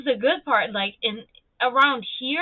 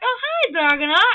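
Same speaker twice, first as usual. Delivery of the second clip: retold cheery greeting; 8 semitones higher, 6 dB louder than usual. Boss echoes the greeting, retold.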